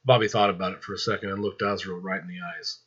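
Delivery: ordinary delivery, nothing marked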